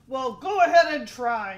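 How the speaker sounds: loud, defiant dare; daring big goat. Acted. Biggest goat dares the troll, loud and defiant. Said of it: "said the biggest billy goat".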